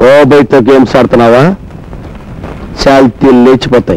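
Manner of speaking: very low, monotone movie-hero challenge; tough-guy threat. Pretend. Sidekick challenges a man to release the girl, menacing. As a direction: play the dangerous rescuer, deep and measured. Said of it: "dialogue part వరకే మందండి".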